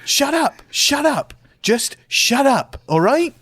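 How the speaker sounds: high-pitched, exasperated scolding; exasperated outburst. Acted. Acted quarrel with the hamster.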